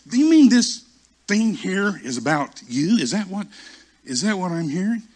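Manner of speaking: high, loud, disbelieving interrogation; incredulous rebuke. Retold or imitated. Holy Spirit questioning the self-righteous preacher, high incredulous rebuke.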